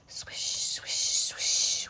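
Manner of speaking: whispered; whispered swish. A narrator's reading. Narrator whispering for the lying-low fish. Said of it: "says the fish".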